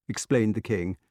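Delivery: plain reading voice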